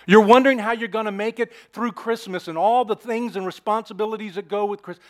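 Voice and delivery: normal talking voice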